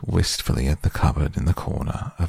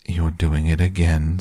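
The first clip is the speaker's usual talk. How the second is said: usual speaking voice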